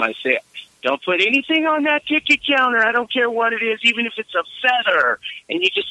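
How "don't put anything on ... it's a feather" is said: high-pitched barked sergeant order; drill sergeant yell. Retold or imitated. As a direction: bark it like a movie drill sergeant. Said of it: "my Full Metal Jacket sergeant routine".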